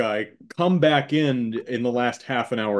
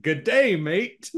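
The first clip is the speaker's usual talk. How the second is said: hammed-up Australian greeting, leaping high then dropping; mock Australian accent; 6 semitones higher, much slower than usual. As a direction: do a cheesy Australian impersonation. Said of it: "We hear Irish and we're like".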